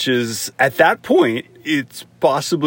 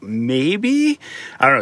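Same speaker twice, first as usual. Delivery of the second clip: hesitant admission. Retold or imitated. Answering a probing therapist in a retelling, hesitant. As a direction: let it climb like a doubtful question. Retold reply to therapist's question.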